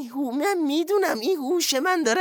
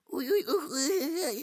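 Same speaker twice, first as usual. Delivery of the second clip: pained moaning; 8 dB quieter than usual. Acted. Boss groaning that he is dying, soft pained moans. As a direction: moan softly as if in agony.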